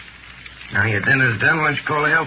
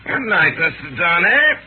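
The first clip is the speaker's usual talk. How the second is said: normal talking voice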